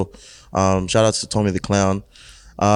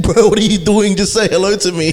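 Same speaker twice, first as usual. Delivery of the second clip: exasperated; 9 semitones higher, 3 dB louder than usual. Retold or imitated. Retold reply to fans.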